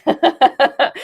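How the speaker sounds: bursts into a high giggle; laughing. Real, not acted. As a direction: giggle it out, embarrassed. Shy laugh at accent request.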